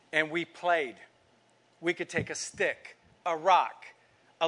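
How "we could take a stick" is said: ordinary talk, nothing marked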